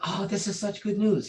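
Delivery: put-on high, thrilled old teacher; delighted exclamation. Grandmaster hearing of pregnancy, delighted and high. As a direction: gush over the good news.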